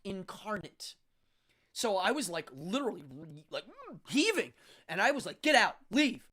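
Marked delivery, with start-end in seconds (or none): 5.4-6.3 louder, higher command; mock yell to get out. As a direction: drive the person out. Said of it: "I yelled at this person".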